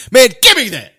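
high, loud, laughing tough guy; quoting tough guy. Retold or imitated. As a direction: bark it with a laugh. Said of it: "And then he said".

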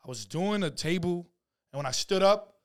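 ordinary talk, nothing marked

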